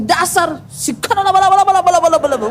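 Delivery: laughing, babbling laba laba in mock tongues; mock speaking in tongues. Pastor mocks an angry couple in tongues, laughing. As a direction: fake glossolalia in a spat, half laughing. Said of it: "bahasa roh keluar".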